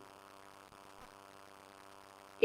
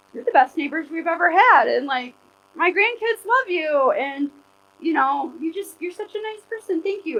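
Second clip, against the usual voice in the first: higher, gushing voice of the neighbor lady; grateful neighbor; 7 semitones higher than usual. Retold or imitated. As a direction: lightly voice a thankful neighbor praising her. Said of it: "was like".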